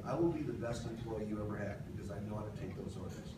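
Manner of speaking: ordinary delivery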